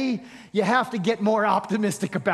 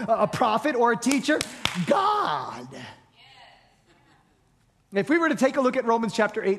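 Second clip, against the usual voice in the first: ordinary talk, nothing marked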